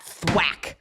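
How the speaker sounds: punchy, drawn-out thwack; word as a sound effect; imitated impact sound. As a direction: say thwack like the smack itself. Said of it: "And the sound, like, that".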